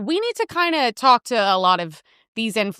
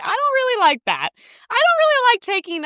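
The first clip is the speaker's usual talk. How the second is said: mock innocent; 12 semitones higher than usual. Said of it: "I'm like".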